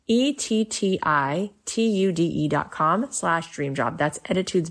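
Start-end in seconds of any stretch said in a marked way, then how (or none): 0.1-3.1 letters spelled out slowly. Shown as a demonstration. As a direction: spell each letter clearly.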